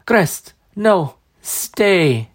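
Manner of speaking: high, gasping dying crone; gasping old woman. Acted. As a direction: gasp it out urgently. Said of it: "she gasped".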